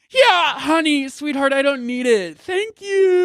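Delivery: high, sassy put-on voice, honey and sweetheart; sassy brush-off. Brushing off the friend's acting tip in a high, campy voice.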